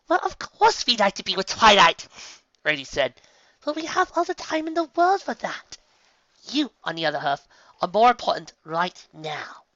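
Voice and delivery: high, loud elegant lady; Rarity voice. Acted. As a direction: reassure her graciously. Rarity reassures Sunset.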